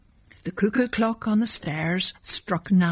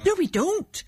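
put-on a much higher, eager child's protest; 12 semitones higher than usual. A narrator's reading. A little boy eagerly contradicting his father about Santa. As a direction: insist brightly, full of hope. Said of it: "he said eagerly".

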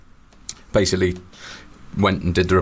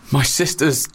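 higher-pitched, mock-tearful excuse, slowing at the end; imitated tearful plea; 7 semitones higher, slower than usual. Photographer pleading for a flight seat, mock tearful. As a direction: lightly act about to burst into tears. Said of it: "just burst into tears".

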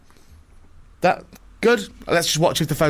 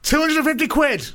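mock sales pitch; 10 semitones higher than usual. Hamming up laptop sale on radio.

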